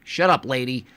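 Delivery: ordinary delivery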